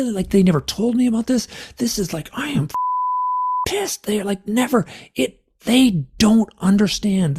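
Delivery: high-pitched, exasperated outburst; strained high protest, breaking off the swear words; retold screaming. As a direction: act out a furious, desperate rant. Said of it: "And I'm screaming".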